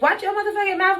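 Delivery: very high, indignant comeback; retold angry retort. Warning the other woman at the door, retold angry retort. Acted quarrel, snaps back at woman.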